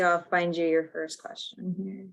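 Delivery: normal talking voice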